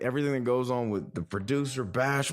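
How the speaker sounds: ordinary talk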